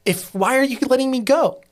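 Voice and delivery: retold urgent protest to the client; pleading protest. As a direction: stop her, high and insistent. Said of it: "here I was like".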